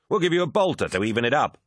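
quick, high, jocular jibe; amused heckling; chuckling tease. A narrator's reading. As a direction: toss out a fast teasing offer. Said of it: "chuckled Abaddon".

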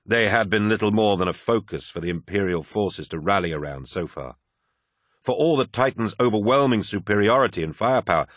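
ordinary delivery, nothing marked

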